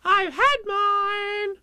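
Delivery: very high, slow squeaky boast; proud little Roo. A narrator's reading. Little Roo tells Tigger proudly, squeaky high voice. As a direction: Roo showing off his medicine. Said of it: "Roo said proudly".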